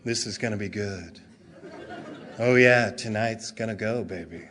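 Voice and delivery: very low, hushed late-night ad voice; smooth commercial announcer. Acted. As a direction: purr it like a sultry TV pitchman. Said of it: "the announcer's voice comes out and goes".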